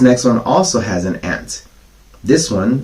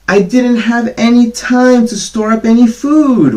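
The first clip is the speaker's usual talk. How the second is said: much higher, louder pleading whine; whiny grasshopper; 13 semitones higher, 6 dB louder than usual. Acted. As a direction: whine like a starving little bug. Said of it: "whined the grasshopper".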